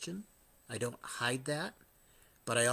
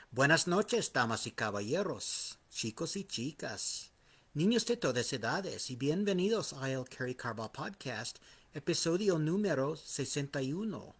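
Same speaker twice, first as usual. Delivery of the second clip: booming Spanish ring announcer; lucha libre announcer; 3 semitones higher than usual. Acted. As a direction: roll it out like a wrestling MC. Spanish repeat of show intro.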